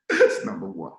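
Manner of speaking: ordinary talk, nothing marked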